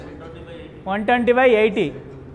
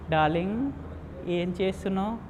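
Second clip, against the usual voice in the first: tender darling; 3 semitones lower, 10 dB quieter than usual. Retold or imitated. Lover's daily phone call.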